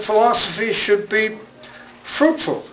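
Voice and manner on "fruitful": fruitful pitched much higher, then falls; emphasis on fruitful. Shown as a demonstration. Lecturer to the audience, stressing fruitful high. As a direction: lift fruitful high and let it drop. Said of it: "I put a lot of emphasis on the word fruitful".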